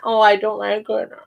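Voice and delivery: acted letdown over banana, teacher demo; mock disappointment. Shown as a demonstration. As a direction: play it sadly disappointed for kids. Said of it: "I add fruit to it and I say".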